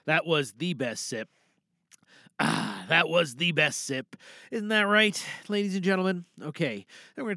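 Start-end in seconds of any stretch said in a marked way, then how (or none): none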